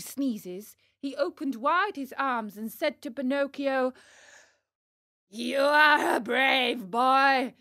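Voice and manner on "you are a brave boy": loud, slow, big-hearted call; booming ogre won over; moved puppet master. Acted. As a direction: welcome the boy loudly with open arms. Fire Eater, softened, embraces Pinocchio.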